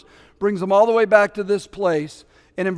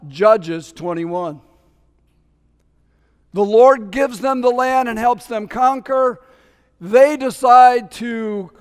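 normal talking voice

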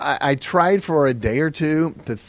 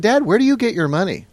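put-on higher-pitched puzzled little boy; boyish, high-pitched question; curious child; 8 semitones higher, 4 dB louder than usual. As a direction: quote a kid quizzing his dad. Curious child questions his dad.

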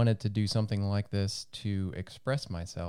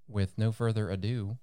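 usual speaking voice

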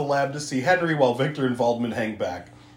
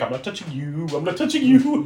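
put-on childish singsong taunt, climbing higher; Fritz; 4 semitones higher than usual. Hunchback hovering around the monster, singsong mock taunt rising in pitch. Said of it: "Fritz is just hovering around like".

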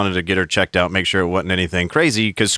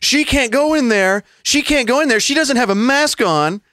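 mock yelling; 13 semitones higher than usual. Random man objecting to the sister going in, mock yelling. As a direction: imitate a busybody hollering in protest. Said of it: "gets out and starts yelling".

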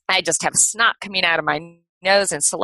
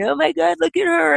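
mocking kids; 11 semitones higher than usual. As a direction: sneer it like a schoolyard bully. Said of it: "kids that were kinda laughing at me and saying".

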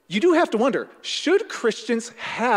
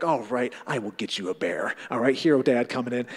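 lower, deep mock-heroic dad voice; 7 semitones lower, 4 dB quieter than usual. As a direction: puff up like a dad about to save the day. Dad playing hero for kid.

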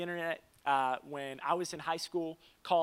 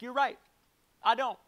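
higher, louder, caught-out agreement; husband caught out by his wife, sheepish and louder; sheepish admission; 7 semitones higher, 8 dB louder than usual. Retold or imitated. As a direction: own it quickly and sheepishly. Husband admits fault to wife.

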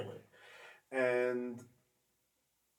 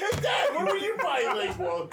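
laughing tease; 12 semitones higher, 7 dB louder than usual. Pretend. Teasing dad, laughing.

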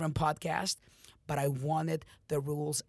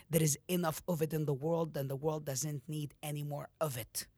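normal talking voice

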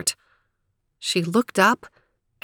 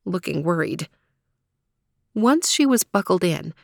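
plain reading voice

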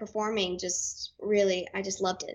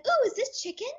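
imitating a kid's high-pitched, wondering question; curious little kid; 12 semitones higher than usual. Quoting little brother's innocent question.

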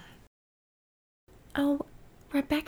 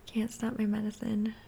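muttered aside; 10 dB quieter than usual. Real, not acted.

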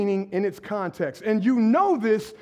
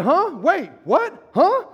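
higher, louder hammed-up bewilderment; mock confusion; 6 semitones higher, 4 dB louder, slower than usual. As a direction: play baffled surprise. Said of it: "I said".

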